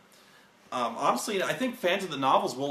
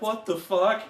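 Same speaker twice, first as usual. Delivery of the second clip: mock disbelief; 7 semitones higher, 3 dB louder than usual. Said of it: "I'm like".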